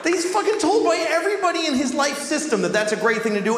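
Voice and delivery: escalating comedy rant. Real, not acted.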